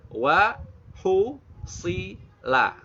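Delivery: WA HUSSILA very slow, each vowel fully shaped; slow-motion syllables. Shown as a demonstration. Teacher slows it down for learners, syllable by syllable. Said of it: "Kalau mau di slow gitu".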